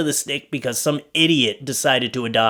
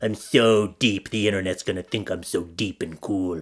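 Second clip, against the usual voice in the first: mocking emo teen; 6 semitones lower, 4 dB quieter than usual. Mocking an emo teen posting online, lower and mopey. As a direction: drone it like a kid who thinks he's profound. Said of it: "some emo teen just typing his thoughts like".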